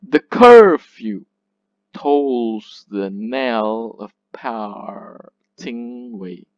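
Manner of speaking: CUR of "curfew" drawn out and loud, "The" light; very slow, every stressed beat stretched in "tolls the knell of parting way"; iambic scansion. Shown as a demonstration. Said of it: "unstressed, followed by a stressed syllable".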